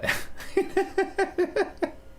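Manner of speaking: high, wheezy staccato giggle; giggling. Real, not acted. Streamer amused at his own accent slip, giggling. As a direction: crack up in a stuttering high laugh.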